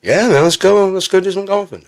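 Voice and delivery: high, excited buddy voice; hammed-up high enthusiasm; eager acted reply. Acts out eager golf reply.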